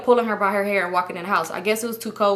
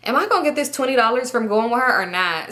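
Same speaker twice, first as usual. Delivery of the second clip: usual speaking voice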